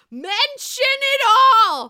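high, very loud mock screech; Bethany. Quoting a classic New York scene, high mimicked yell. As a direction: yell it out, stretching the words. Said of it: "just before Bethany says".

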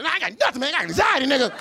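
high-pitched defensive yelp; hammed-up indignant protest; mock angry outburst. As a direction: snap back hotly as a broke man. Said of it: "poor people are the ones that get angry".

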